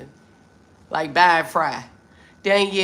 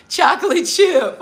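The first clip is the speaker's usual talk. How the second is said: delighted; 10 semitones higher, 8 dB louder than usual. Real, not acted. Reading a cute username.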